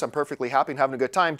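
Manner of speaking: low, soft, fast monotone; deadpan rapid mumble; same-voice impression. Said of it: "He went from talking like this".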